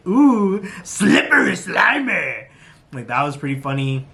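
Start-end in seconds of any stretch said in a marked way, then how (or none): 0.1-2.5 high, drawn-out mock squeal; contestant's cry. Repeating the contestant's funny cry, high mock wail. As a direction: stretch it into a silly high wail. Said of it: "somebody was like".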